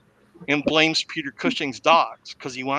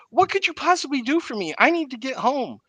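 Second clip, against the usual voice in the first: indignant lady; 10 semitones higher than usual. Retold or imitated. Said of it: "Joan Collins steps up and says".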